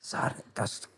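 unvoiced hushed TV-intro whisper. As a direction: whisper it like a children's TV narrator. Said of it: "den der stemme, der hvisker".

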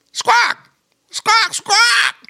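high, loud parrot cries; parrot squawk. A narrator's reading. Parrot Squawk calling out on the river, loud high squawks. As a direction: screech like a parrot. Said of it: "When Squawk called out".

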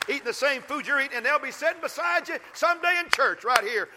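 high, loud preaching climb; fiery preaching. Acted. Pastor urging the congregation about the workplace, fiery preaching.

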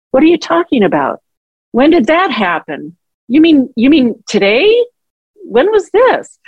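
high, puzzled questions; retold bewilderment. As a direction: imitate baffled disbelief, pitch climbing. Forgetful dad answers her apology, acted.

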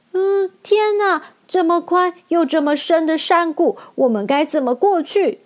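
put-on anxious girl. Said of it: "陶乐斯急得搓着手".

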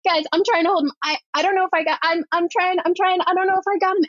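high, rushed, repetitive mock panic; panicking. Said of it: "like, panicking and this guy yelling".

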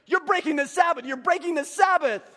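loud, high mock scolding; retold yelling. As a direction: imitate an outraged stranger yelling an accusation. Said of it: "but he's yelling at me".

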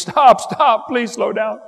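put-on high, loud panicked kid shriek; kids screaming. Kids on a spinning merry-go-round begging the players to slow down, shrieking high. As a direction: beg them to stop like a terrified child. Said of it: "But now they're screaming".

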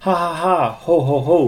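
high loud teasing laugh. A narrator's reading. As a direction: laugh it off merrily. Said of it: "laughed the two little pigs again".

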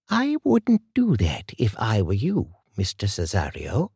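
a hint of a composed older woman stepping from the shadows; calm older woman. A narrator's reading. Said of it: "a voice calmly said".

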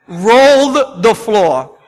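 loud, slow shout; booming shout of the words; shouted repeat. Acted. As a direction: belt it out slowly. Preacher repeats phrase loudly.

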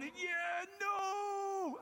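high, crying mock wail of a patient; whiny protest. Optician mimicking a frightened patient, high crying protest. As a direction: wail like a scared patient refusing.

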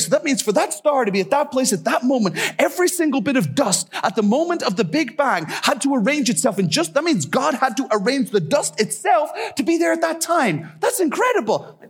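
high-pitched excited discovery; amazed realization. Retold or imitated. Japanese guy working out the star's meaning, excited amazement. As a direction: act out mounting amazement, pitch climbing.

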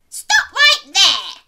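very loud, high, slow command; yelling. Acted. Granny bursting in on the wolf, full yell. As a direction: yell it with force on each word. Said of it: "yelled Granny".

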